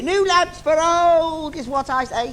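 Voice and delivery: high, loud street call; villain repeating his lamp cry; villain's cry. Acted. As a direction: hawk it like a panto baddie. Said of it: "That's my cry".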